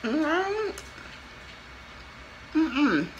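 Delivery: ordinary talk, nothing marked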